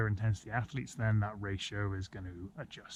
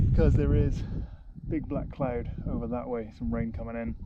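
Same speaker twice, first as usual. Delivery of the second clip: over the wind; 6 dB louder than usual. Real, not acted. On windy mountaintop.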